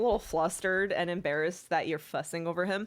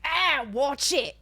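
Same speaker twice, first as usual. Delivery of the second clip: sharp mock yelp; 8 semitones higher, 9 dB louder, slower than usual. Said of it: "Sarah's like".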